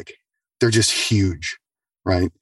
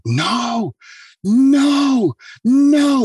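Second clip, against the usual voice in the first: very high, louder panicked no; freaking out; 4 dB louder, much slower than usual. Retold or imitated. Patient panicking at the bathroom door, very high cries. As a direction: panic rising on each no. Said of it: "freaking out".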